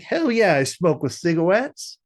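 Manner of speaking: imitating Riley. Friend retells a cartoon kid bragging, high mock boast. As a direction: crow it like a kid playing gangster. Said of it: "he's like".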